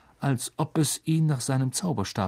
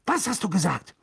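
sharp, raised-pitch demand; irritated villain's question; snarling; 5 semitones higher than usual. A narrator's reading. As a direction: snap back indignantly. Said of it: "fauchte Mister Malfoy".

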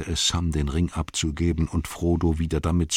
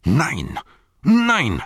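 second no flies much higher and louder; screaming; 9 semitones higher, 5 dB louder than usual. Acted. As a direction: shriek the refusal, clutching the ring. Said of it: "schrie Frodo".

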